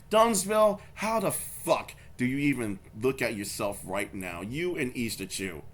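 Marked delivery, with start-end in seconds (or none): none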